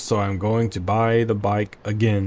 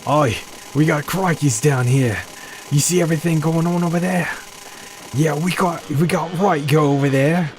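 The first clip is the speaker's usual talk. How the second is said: hammed-up Aussie accent, higher and quicker; mock Australian accent; 5 semitones higher than usual. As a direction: play a jokey outback bloke. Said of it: "How do Australians speak?".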